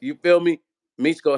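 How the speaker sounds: ordinary talk, nothing marked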